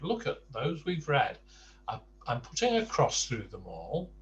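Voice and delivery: ordinary delivery, nothing marked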